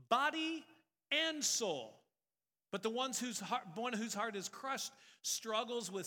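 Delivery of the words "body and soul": body and soul, loud and high; loud preaching emphasis. Acted.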